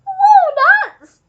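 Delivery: very high, loud monkey shriek; scream. A narrator's reading. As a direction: scream it in thrilled disbelief. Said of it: "screamed the monkey".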